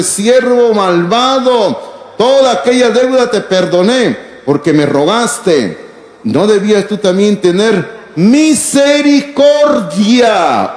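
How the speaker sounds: slow, weighty reproach; a hint of a stern king; stern slow rebuke. Reading the king's rebuke. (reproche pausado del rey)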